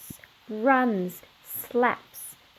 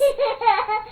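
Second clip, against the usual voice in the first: a bright high-pitched giggle; 11 semitones higher than usual. Acted. Little girl giggling in the rain. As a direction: giggle up high like a delighted child. Child joins dancing in rain.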